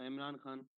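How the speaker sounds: usual speaking voice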